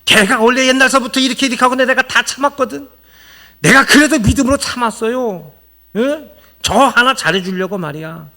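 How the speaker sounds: high, loud whiny griping; aggrieved complainer. Retold or imitated. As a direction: act out a wronged friend airing grievances. Preacher voices aggrieved gossiper.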